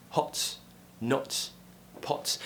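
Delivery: quick round O in "hot", "not", "pot"; short British O. Shown as a demonstration. Teacher gives example words, "hot", "not", "pot" with short round O. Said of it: "The next sound is the O sound in words like".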